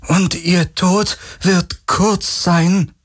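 high, very slow, icy strained voice; cold threat. A narrator's reading. Possessed Kirai threatens Garland, strained and icy. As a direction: promise death with calm cold menace.

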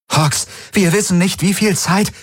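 high, pressing objection; urgent protest. A narrator's reading. Kirai argues with Hox under fire, high urgent protest. Urging, cut off by explosion.

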